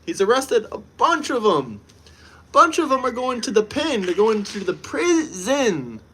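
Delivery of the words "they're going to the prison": usual speaking voice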